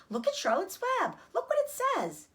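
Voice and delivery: high, eager calling out; excited cry. Acted. Fern shows everyone the new web, calling out high. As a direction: point it out with delight. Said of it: "cried Fern".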